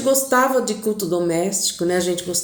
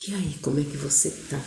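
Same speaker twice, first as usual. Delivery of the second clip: quick, soft question said close; murmured in her ear; 5 semitones lower, 7 dB quieter, faster than usual. Retold or imitated. Husband whispering to wife on arriving, soft. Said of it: "Ele gostava de sussurrar no meu ouvido".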